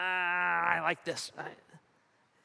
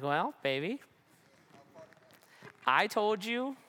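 teasing; 5 semitones higher than usual. Retold or imitated. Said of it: "teasing wife".

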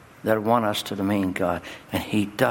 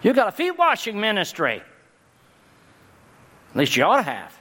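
climactic preaching; 10 semitones higher than usual. Acted. Pastor charging the congregation to a foot-washing ministry, in a high preaching shout. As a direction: hurl each line out to the back pew.

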